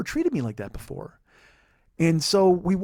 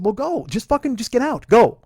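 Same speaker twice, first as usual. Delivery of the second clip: raised, loud get-out order, last word shouted; angry dismissal; 6 semitones higher, 7 dB louder than usual. Retold or imitated. To girlfriend in the parking lot, a loud angry send-off. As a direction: push her away with a rising yell.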